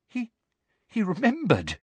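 high, faltering disbelief; frightened stammer. A narrator's reading. Girl shocked the giant knows her, frightened stammer.

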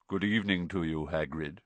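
subdued, mournful centaur voice; deep, sorrowful. A narrator's reading. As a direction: greet him with understated sorrow. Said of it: "He had a deep, sorrowful voice".

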